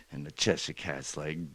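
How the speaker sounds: ordinary delivery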